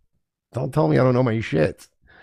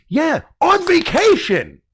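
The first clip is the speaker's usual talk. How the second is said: much higher, slow, whiny mock voice; mocking put-on voice; 14 semitones higher, slower than usual. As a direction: stretch it out in a high, prissy parody. Mocking the couple's defense.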